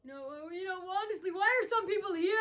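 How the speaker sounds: mimicking hecklers. Vlogger mimics complainers at the store, high and mocking. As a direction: build upward toward a mock scream. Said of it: "They start screaming".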